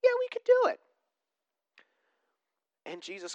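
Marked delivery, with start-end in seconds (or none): 0.0-0.8 high eager squeak of the brothers; eager disciples. Retold or imitated. Disciples James and John answering Jesus, squeaky eager. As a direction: pitch it way up, overeager. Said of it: "And James and John go".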